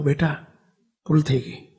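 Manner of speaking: hushed, sorry parents' reply; soft apology. Retold or imitated. Parents quietly apologizing to their sick son, hushed. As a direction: say it softly, like a guilty parent.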